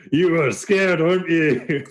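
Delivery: high, teasing character voice; Dahlin. Acted. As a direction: tease them in a high character voice. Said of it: "He's like".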